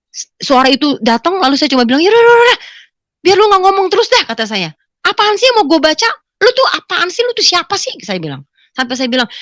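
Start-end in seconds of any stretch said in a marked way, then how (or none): 2.0-4.2 high, loud, hurried brush-off; retold burst of impatience at a pestering voice; exasperated outburst. Fed up with the voice. 5.0-7.9 high-pitched irritated questioning; retold annoyed demand; exasperated challenge. Challenges the unseen voice.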